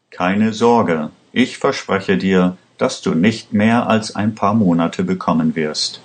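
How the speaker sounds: ordinary delivery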